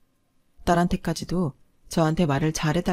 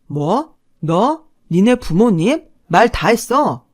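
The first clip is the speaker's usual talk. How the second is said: short questions climbing louder and sharper; affronted ex-husband; 3 semitones higher, 8 dB louder, much slower than usual. A narrator's reading. Ex-husband bristling at ex-wife's rebuke, affronted. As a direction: build from disbelief to an angry challenge.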